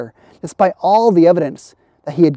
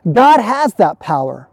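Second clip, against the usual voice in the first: ordinary talk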